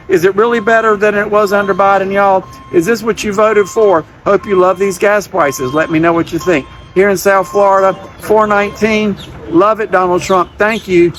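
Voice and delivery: ordinary talk, nothing marked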